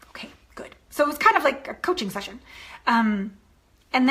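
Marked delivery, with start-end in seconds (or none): 0.1-0.7 breathy whispered approval; whispered okay to a crying client; soft whisper. Retold or imitated. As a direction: hush it, gently encouraging. Coach responds to breakthrough.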